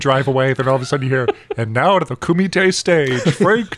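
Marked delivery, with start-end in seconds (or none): 1.6-3.8 lightly mimicked ring announcer, rising up high on the name; announcer call. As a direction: call out Frank Dukes like a tournament MC. Tournament announcer calls out name.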